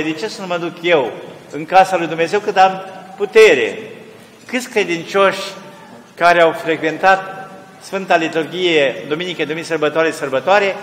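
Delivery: preaching at full force. Acted. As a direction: warn the flock, rising to a cry. Preacher warns congregation, building.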